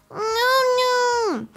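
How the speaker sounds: put-on high, squeezed toddler refusal; whining baby. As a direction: play a fussy baby protesting.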